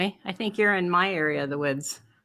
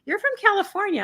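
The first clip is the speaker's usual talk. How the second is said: higher-pitched quoted voice; imitating surprised foreigners; 12 semitones higher, faster than usual. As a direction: mimic people abroad spotting where you're from. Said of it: "and they go".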